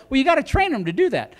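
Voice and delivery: normal talking voice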